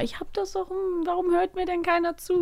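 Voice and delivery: hohe, leise, weinerliche Kinderstimme; klagender Charlie Brown. Retold or imitated. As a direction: jammern wie ein übergangenes Kind. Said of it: "der sagt auch immer". (leise klagende Kinderstimme)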